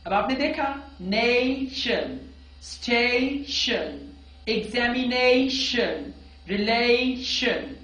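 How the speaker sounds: slow, drawn-out -शन endings; slow repeat. Shown as a demonstration. Teacher repeating -tion words slowly for students. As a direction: linger on each -शन ending. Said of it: "imitate करेंगे".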